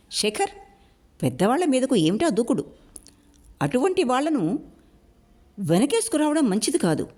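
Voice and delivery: high, scolding elder tone; reproving. Acted. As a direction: chide him from above. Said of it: "మందలిస్తున్నట్టుగా". (మందలిస్తున్నట్టుగా, ఎత్తైన స్వరంతో)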